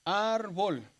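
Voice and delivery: "Árbol" with a drawn-out, stressed ÁR; "Árbol", strong first syllable, falling second. Shown as a demonstration. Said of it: "donde se pronuncia más fuerte".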